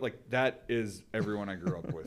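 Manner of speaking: ordinary talk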